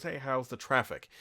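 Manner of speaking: ordinary delivery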